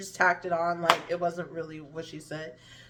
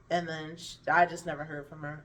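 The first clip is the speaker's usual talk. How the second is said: ordinary delivery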